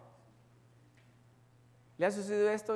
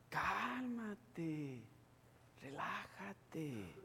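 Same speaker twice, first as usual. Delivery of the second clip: much softer mock calming; soothing; 12 dB quieter, much slower than usual. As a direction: hush them down gently. Imitating soothing voice.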